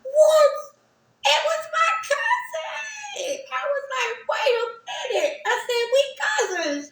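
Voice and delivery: high, crying joy breaking through; overjoyed, voice cracking with tears; shouting through happy tears; tearful excitement. Real, not acted. Reunion with cousin, crying.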